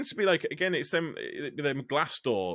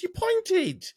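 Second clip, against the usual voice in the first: higher, louder insistence on "pointed"; pointed accusation; 3 dB louder than usual. Retold or imitated. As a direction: hammer home "You pointed" as an accusation. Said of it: "I said".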